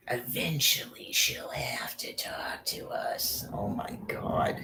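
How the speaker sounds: slow, breathy whisper; hushed, secretive murmur. Real, not acted. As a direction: lean in and whisper the aside. Whispered aside.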